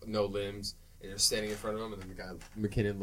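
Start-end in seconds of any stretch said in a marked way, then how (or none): none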